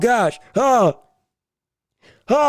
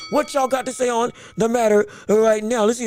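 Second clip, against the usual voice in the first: normal talking voice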